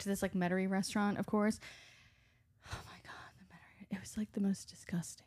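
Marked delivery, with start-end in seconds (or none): 2.7-5.2 whispered, as if sharing scandal; hushed gossip. Real, not acted. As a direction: drop to an appalled whisper. Dishing about a disgusting restaurant.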